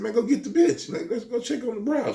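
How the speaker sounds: high, pushing request; telling a friend to check on the woman; urging the friend. Retold or imitated. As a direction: send him to go look.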